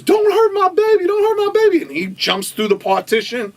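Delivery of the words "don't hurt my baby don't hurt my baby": loud, very high mock shriek; frantic high-pitched plea; panicked baby mama. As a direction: imitate a mother screaming for her child. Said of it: "the baby mama goes".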